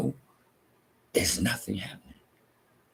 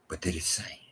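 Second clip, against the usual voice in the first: whispered; 8 dB quieter than usual. Shown as a demonstration. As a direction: whisper it, barely voiced. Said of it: "One more time".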